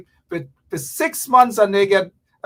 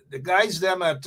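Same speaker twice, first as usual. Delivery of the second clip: ordinary talk, nothing marked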